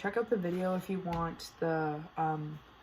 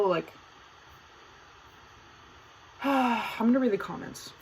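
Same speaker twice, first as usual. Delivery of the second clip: normal talking voice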